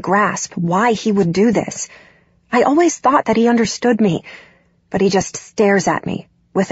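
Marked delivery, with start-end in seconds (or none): none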